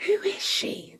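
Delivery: breathy high whisper; whispered question. A narrator's reading. Guests whispering about the stranger, high whisper. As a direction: whisper it behind a hand. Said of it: "they asked".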